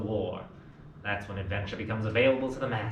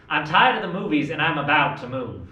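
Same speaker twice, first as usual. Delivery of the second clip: restless outburst; 4 semitones higher, 7 dB louder than usual. Acted. Tom's restless outburst to Jim.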